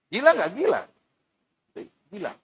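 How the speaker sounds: high taunting call; lightly voiced mockers jeering; mocking jeer. Mockers call Noah mad.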